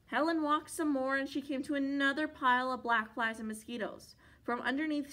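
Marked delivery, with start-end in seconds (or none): none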